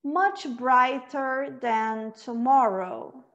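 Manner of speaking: slow, measured recital with long pauses to repeat; poem recitation. Shown as a demonstration. Teacher speaks lines for students to repeat, slow poem recitation. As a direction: recite it line by line, unhurried. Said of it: "a gente vai pensar em recitar".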